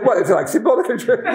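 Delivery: high, laughing reply; laughing through it. Real, not acted. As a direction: try to answer while laughing. Retold own excuse, laughing.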